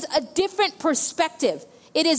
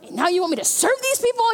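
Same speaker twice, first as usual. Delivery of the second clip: high, exasperated mock complaint; 6 semitones higher than usual. Pastor reliving her frustration after being vomited on, exasperated and high. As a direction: throw up your hands at having to serve. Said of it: "I'm just like".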